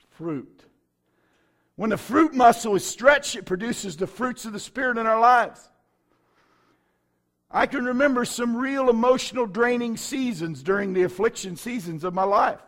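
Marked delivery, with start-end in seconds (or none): none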